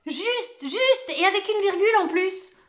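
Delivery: excited cheer. A narrator's reading. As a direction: cry it out in triumph. Cheering the correct answer.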